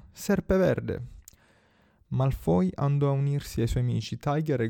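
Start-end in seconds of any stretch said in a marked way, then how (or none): 0.1-1.1 verdetto gridato, misurato, più acuto; grido del cappello. A narrator's reading. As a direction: proclama il verdetto alzando il tono. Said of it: "quando gridò". (verdetto gridato)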